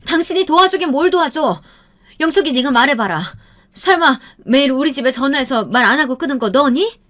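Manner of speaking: high, loud accusing voice; angry mother. A narrator's reading. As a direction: demand the truth, furious. Mother confronts the girl.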